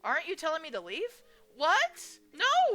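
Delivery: high, loud incredulous what and no; shocked denial. Acted. Sam denying he wants her gone, shocked. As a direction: refuse the idea outright. Confusion over leaving.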